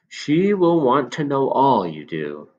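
put-on a knowing old shopkeeper confiding; old man. Old man voiced in story.

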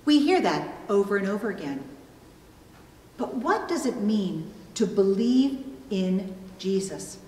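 ordinary delivery

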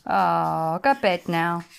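low mock-sad groan of a line; mock pity. Real, not acted. Owner putting on low mock sympathy for the dogs. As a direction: drop it low in fake sympathy.

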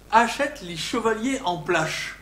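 verse spoken with a Picard accent. Shown as a demonstration. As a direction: recite with a Picard accent, plache for place. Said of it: "je vous cite du picard".

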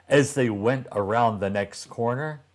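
plain reading voice

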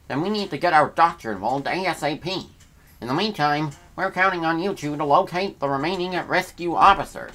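reading game text aloud in character voices; voicing game characters. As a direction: read the in-game lines as the crew. Voicing Pikmin characters' dialogue.

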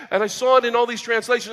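usual speaking voice

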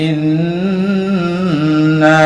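ইন্না very slowly, the doubled ন hummed long through the nose; ghunna on ইন্না. Shown as a demonstration. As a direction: hold the nasal in ইন্না like a hum. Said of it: "এখানে গুন্না হবে".

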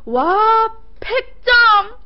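high, loud cutesy cheer; excited cheer. Pretend. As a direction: shout it like a reveal. 귀요미 마무리 외침. (신난 외침)

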